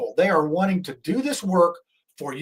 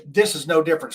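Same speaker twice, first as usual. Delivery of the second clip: ordinary talk, nothing marked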